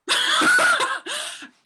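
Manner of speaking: a high burst of real laughter; high delighted laugh. Real, not acted. Laughing at the judge's retort.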